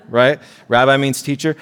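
ordinary talk, nothing marked

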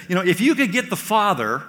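a hammed-up disciple pleading for a sign; eager, naive Philip. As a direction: ask like a clueless friend wanting proof. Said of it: "And so he says".

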